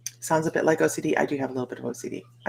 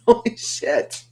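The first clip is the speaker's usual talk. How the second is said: high, louder stunned exclamation; shocked; 7 semitones higher, 5 dB louder, slower than usual. Retold or imitated. Reacting to her husband's gentle remark with shock. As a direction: blurt it out in disbelief.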